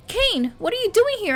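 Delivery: high, loud surprised call; cry out. Acted. Heroine surprised by Kane, crying out. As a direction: call out his name in shock. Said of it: "I cry out".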